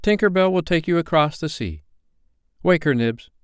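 put-on sharply commanding boy; short, sharp command. A narrator's reading. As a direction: give orders as one accustomed to be obeyed. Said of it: "sharp voice of one accustomed to be obeyed".

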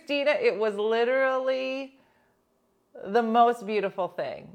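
high, loud gushing. Retold or imitated. Friend gushing to Dina, high and loud.

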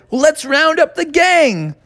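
a hint of a cartoon leader rounding everyone up; Bucky's rallying call. Bucky answers the tired pullers, lightly voiced rallying call. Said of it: "And Bucky said".

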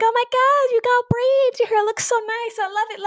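high-pitched mock gushing over hair; gushing coworkers. Coworkers commenting on her braids, mock gushing. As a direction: imitate coworkers squealing compliments, very high.

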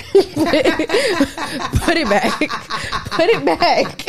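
laughing while pleading put it back; giggling through a demand; laughing plea. Real, not acted. Friend laughing at Snapple's new taste, laughing.

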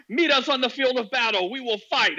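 high, loud mock war cry; mock battle challenge. Streamer imitates debaters calling Chris out, in a loud mock challenge. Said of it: "They're like".

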